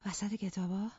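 a touch of bewildered disbelief; confused, distraught. Acted. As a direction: echo it back, lost and upset. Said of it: "سردرگم و پریشان گفت". (سردرگم و پریشان)

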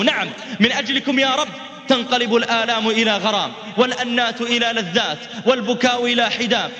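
high, impassioned sermon delivery; fervent preaching. Acted. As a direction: preach it with rising fervour. Preaching climax on martyrdom.

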